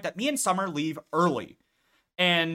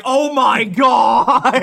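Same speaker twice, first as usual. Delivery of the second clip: drawn-out, loud oh my God; slow loud dread; 6 semitones higher, 10 dB louder, much slower than usual. Retold or imitated. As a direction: groan it out in slow horror. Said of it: "And I'm just like".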